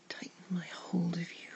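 usual speaking voice

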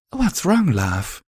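a tender mare's question; gently. A narrator's reading. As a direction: ask him gently what's wrong. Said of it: "Velvet asked gently".

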